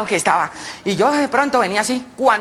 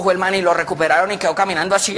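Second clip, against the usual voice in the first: ordinary delivery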